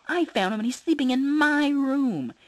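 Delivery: put-on high, loud child shout; shouting kid. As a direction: yell it like a stubborn boy. Kids arguing over rabbit.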